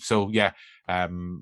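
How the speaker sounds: ordinary delivery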